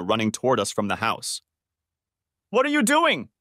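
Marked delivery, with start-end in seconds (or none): 2.5-3.3 much higher, shrill-pitched shout of outrage. A narrator's reading. Wife catches her husband kissing another woman, high outraged shout. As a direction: scream it at them. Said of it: "Dana shouted".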